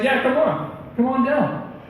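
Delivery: high, easygoing invitation; casual beckoning. Acted. Jesus inviting Peter onto the water, casual high call. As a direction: lightly beckon someone over, voice lifted.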